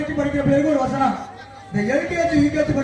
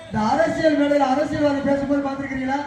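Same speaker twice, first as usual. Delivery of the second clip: ordinary delivery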